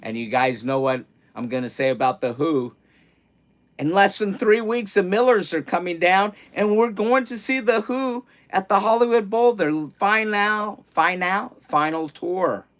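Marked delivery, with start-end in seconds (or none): 3.8-12.7 excited. Real, not acted. Thrilled about seeing The Who.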